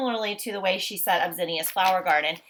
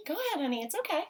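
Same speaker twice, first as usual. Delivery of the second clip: gentle coaxing; 8 semitones higher, 5 dB quieter, much faster than usual. Real, not acted. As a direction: reassure the little one gently. Teacher coaxing a hesitant child.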